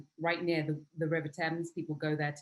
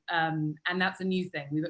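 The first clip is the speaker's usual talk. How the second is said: ordinary delivery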